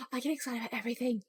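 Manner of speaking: soft, quick, voiced murmur; quiet voice. Shown as a demonstration. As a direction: say it fast and softly. Same words, first take before whisper.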